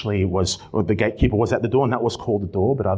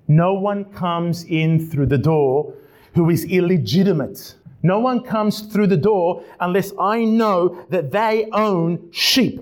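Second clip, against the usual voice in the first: raised, slow, emphatic gatekeeper's declaration; gatekeeper refusing entry; 9 semitones higher, 4 dB louder, slower than usual. Acted. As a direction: lay down the rule slowly and forcefully, pitched high.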